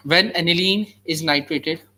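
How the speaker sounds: slow, deliberate dictation; dictating. Shown as a demonstration. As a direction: spell it out slowly for notes. Dictating notes to students.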